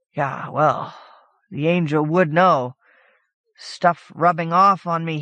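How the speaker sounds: ordinary delivery, nothing marked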